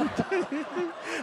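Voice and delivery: high, sudden burst of giggling; burst of laughter. Real, not acted. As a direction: laugh at being found out. (bật cười khúc khích)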